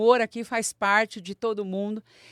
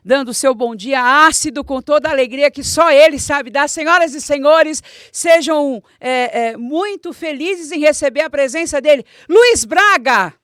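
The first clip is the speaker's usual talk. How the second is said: grand entrance announcement; 3 semitones higher, 9 dB louder than usual. Acted. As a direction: call the guest in with fanfare. Host introduces guest.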